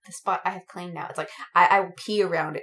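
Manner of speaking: mock territorial. Cohost claiming her parking spot, low mock growl. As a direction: growl like a beast guarding turf.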